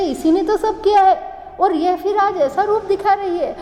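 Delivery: high-pitched, mildly indignant accusation; accusing onlooker. Acted. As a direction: voice a disapproving member of the crowd. Assembly members accuse the queen.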